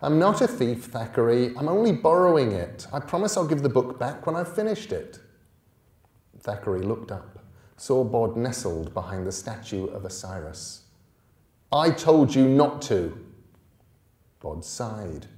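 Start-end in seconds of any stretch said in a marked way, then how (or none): 0.0-5.2 put-on higher, louder boyish protest; young boy protesting. Small boy explaining to the angry bully. Bod defending himself to bully. 11.7-13.2 loud, sharp scolding; angry rebuke. Acted. Older boy scolding Bod over the book.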